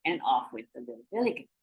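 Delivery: ordinary delivery, nothing marked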